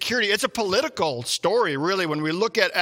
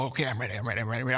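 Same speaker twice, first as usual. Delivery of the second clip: hushed eager reply; 7 semitones lower, 6 dB quieter, much faster than usual. Retold or imitated. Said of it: "And so".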